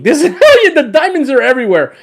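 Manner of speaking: high, loud laughing. Real, not acted. Showing the wacky rod to viewers, laughing. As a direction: laugh through the words.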